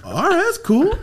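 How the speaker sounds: very high, strained fake okay; forced acceptance. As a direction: squeak it as if coping badly. Feigned acceptance, being left hanging.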